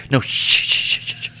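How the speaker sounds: hissed shush over and over; insistent hushing, played for laughs; mock shushing. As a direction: cut off a protest with rapid shushes. Acting out Norrell shushing again.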